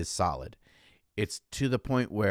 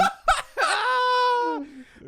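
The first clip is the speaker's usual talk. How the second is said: high wailing laugh; 6 dB louder than usual. Real, not acted. Cracking up at his own joke, high wailing laugh. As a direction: laugh rising into a high crying wail.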